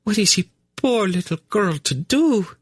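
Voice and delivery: put-on high, artfully tragic little-girl plea; trembling voice. A narrator's reading. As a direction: play the poor victim, tremulously. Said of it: "she asked in a trembling voice".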